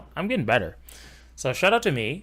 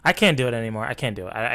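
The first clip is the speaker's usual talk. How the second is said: ordinary delivery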